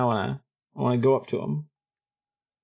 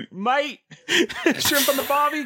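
put-on Australian accent; 5 semitones higher than usual. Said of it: "that explains the accent".

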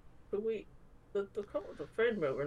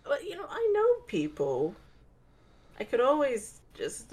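high eager offer; eager pitch; 9 semitones higher, 4 dB louder, slower than usual. Acted. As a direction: jump up, eager to help. Character offers help eagerly.

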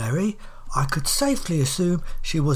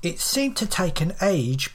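ordinary delivery

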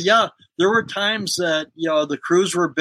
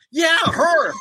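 gleeful shout; 11 semitones higher than usual. Retold or imitated. Confirming the clap rumor to the pranked guy, a gleeful shout. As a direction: shout it, much higher, sealing the prank.

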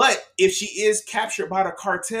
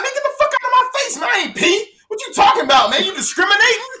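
much higher, louder, fast defensive outburst; hammed-up offended denial; mock indignant; 13 semitones higher, 6 dB louder, faster than usual. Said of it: "I'm like".

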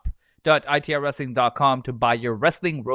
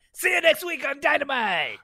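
announcer voice; 13 semitones higher than usual. Retold or imitated. Excalibur sign-off impression.

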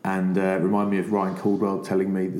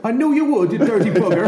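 much higher, louder mock Irish accent; Declan Kidney's Irish accent; 8 dB louder than usual. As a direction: bellow it like a cheeky Irish coach. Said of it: "The accent's kind of important".

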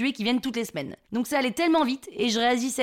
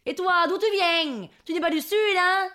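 mock southern yell; 7 semitones higher than usual. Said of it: "Les gens nous hurlent". (interpellation criarde à la marseillaise)

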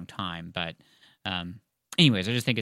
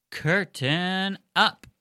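high, drawn-out curtain call; slow, raised-pitch scene opener; theatrical announcement; 9 semitones higher, much slower than usual. Pretend. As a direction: stretch it out like a stage announcer.

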